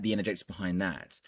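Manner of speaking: ordinary delivery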